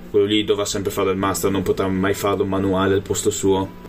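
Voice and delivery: usual speaking voice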